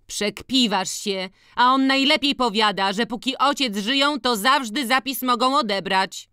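high, insistent village woman; protesting sister. A narrator's reading. Siostra kłóci się z Antkiem. (wysoki głos upartej kobiety)

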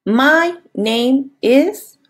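very slow, word by word; slow model answer. Shown as a demonstration. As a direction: spell it out slowly. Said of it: "le vas a decir".

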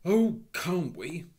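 high, loud, drawn-out sarcasm; boy snapping back sourly; indignant schoolboy retort; bitter rejoinder. A narrator's reading. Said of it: "was the bitter rejoinder of Robert".

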